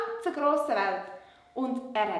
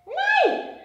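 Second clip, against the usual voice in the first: much higher little puppet voice; 5 dB louder than usual. Acted. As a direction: puppet girl, pitched way up. Puppet Lola answers.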